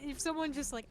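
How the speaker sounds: ordinary talk, nothing marked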